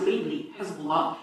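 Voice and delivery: normal talking voice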